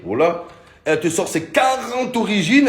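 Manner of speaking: usual speaking voice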